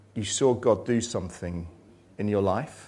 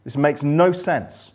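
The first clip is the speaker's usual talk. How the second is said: anguished cry; 7 semitones higher, 6 dB louder than usual. Retold or imitated. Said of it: "and you're saying".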